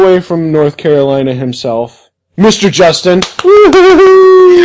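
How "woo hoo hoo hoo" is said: long loud high whoo-hoo; excited whoop. Real, not acted. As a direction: cheer the guest in. Welcoming guest.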